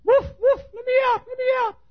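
very high little-dog voice begging; squeaky high dog voice pleading; talking Chihuahua. Acted. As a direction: whine to get out like a caged lapdog. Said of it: "it's a male Chihuahua".

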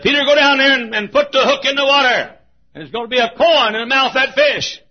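high, shouted instructions; God commanding. Acted. God sending Peter to the water, shouting. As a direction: call it out at full volume. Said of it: "like God said to that fish".

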